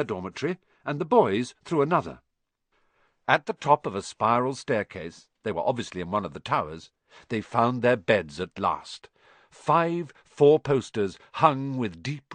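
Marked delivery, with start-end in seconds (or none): none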